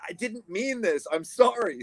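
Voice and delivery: high, plaintive retold apology; hammed-up helpless sorry; pleading apology. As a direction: plead innocence with pitch way up. Retelling own apology to crew.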